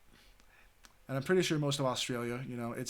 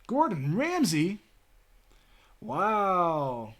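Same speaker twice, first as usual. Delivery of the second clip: surprised; 5 semitones higher, 4 dB louder, much slower than usual. Real, not acted. Finding famous person from hometown.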